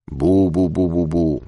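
slow sleepy drone on one note; lazy monotone engine buzz; drowsy droning hum. A narrator's reading. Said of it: "привычно дремотно гудела".

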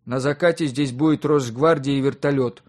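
usual speaking voice

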